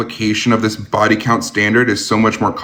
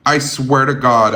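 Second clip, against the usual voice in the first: ordinary talk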